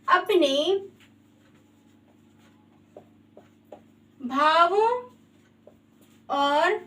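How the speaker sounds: word by word with long gaps; spaced-out, unhurried dictation; slow dictation. Shown as a demonstration. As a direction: dictate slowly as if writing it out. Dictating the definition while writing.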